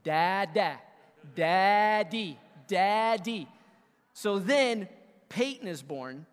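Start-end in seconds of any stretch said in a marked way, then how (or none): 0.0-3.5 slow, loud, drawn-out syllables; coaxing an unborn baby. Retold or imitated. Dad-to-be talking to wife's stomach, slow loud coaxing. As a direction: coach the baby word by word. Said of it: "I'm talking to her, her stomach".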